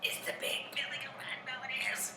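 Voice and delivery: high, tiny whispery squeak; hushed excitement. Acted. Troll hearing the big goat overhead, hushed and excited.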